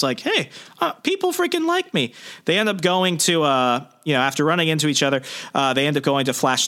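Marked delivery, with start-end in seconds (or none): 0.2-2.1 put-on much higher, giddy and thrilled teen voice. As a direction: squeal it like a kid who just got popular. Peter thrilled at being liked.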